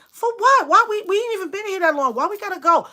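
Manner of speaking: high, loud indignant complaining; whiny protest. Retold or imitated. Friends in the background complaining, high and whiny. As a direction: protest leaving the party.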